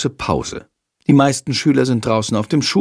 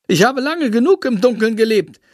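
a lightly voiced proud bat, higher; grand bat voice; 9 semitones higher than usual. A narrator's reading. Bat proclaims to the children, lightly pompous higher voice. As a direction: announce yourself like a vain showman.

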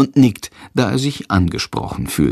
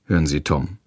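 plain reading voice

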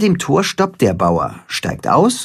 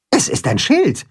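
Timme; 8 semitones higher than usual. A narrator's reading. Timme antwortet.

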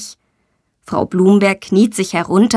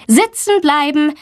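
higher-pitched firm teacher's command; firm order; 6 semitones higher than usual. A narrator's reading. Teacher keeps excited pupils seated, firm command. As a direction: order the class firmly, pitched up. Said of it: "bestimmt Frau Blumberg".

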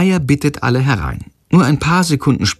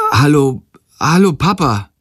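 flustered; 5 semitones higher than usual. A narrator's reading. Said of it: "sagt er verdattert".

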